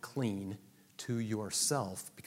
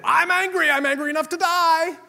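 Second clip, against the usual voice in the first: much higher, much louder furious wail; angry Jonah; 15 dB louder than usual. Acted. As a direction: rant like a sulking prophet. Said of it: "what does he do".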